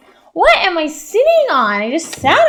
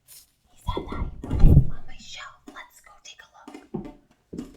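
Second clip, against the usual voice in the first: soft secret whisper; 8 dB quieter, faster than usual. Real, not acted. Teacher whispering to the class about the box. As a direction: hush it like a secret.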